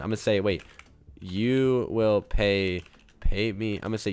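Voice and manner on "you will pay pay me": very slow, low, halting words; dictating while typing. Real, not acted. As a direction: say it word by word as you type. Types the message word by word.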